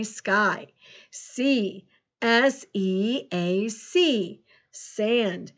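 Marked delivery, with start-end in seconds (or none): 2.2-3.7 slow, letter by letter; measured, separated letters; spelling aloud. Shown as a demonstration. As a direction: spell it out slowly for a class. Teacher spelling a word aloud.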